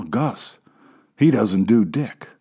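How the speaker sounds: plain reading voice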